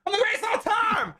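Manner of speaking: high, fast, strained yell; crazed megalomaniac shout. Acted. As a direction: rattle off the boast in a manic shriek. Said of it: "sounding like [name] on, like, steroids".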